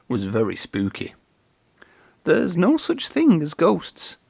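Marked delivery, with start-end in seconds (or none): 2.2-4.2 nervous self-reassurance. A narrator's reading. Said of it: "he said to himself".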